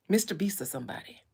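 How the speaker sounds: whispered aside. Acted.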